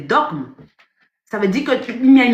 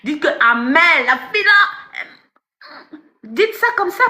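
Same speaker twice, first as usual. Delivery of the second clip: mocking gossip voice; 4 semitones higher, slower than usual. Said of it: "Dites même que je suis une menteuse".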